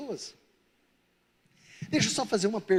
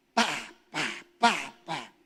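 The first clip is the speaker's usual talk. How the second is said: repeated short, lower bangs; imitated gate banging; 6 semitones lower, much slower than usual. Man playing with the remote control, imitated gate bangs. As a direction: voice a gate going up and down.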